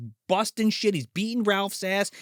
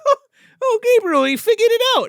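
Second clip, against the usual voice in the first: higher, louder alarmed woman; alarmed witch voice; 8 dB louder, faster than usual. Retold or imitated. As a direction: warn your partner the secret is out. Witch calling to Gabriel.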